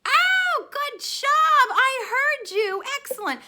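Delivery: high, loud delighted cheer; a big teacherly cheer; excited praise. Real, not acted. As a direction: burst out with delight.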